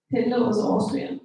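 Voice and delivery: usual speaking voice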